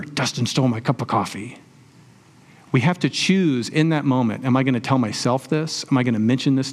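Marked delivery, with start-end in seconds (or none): 0.0-1.6 an inner voice that won't let it go; resentful inner thought. Pretend. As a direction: replay the grievance in your head, slightly hushed. Memory of grudge resurfacing.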